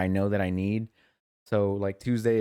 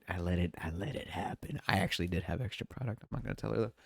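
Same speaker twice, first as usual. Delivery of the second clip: much softer, secretive confession; confiding aside; 8 dB quieter than usual. Real, not acted. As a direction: let them in on a secret.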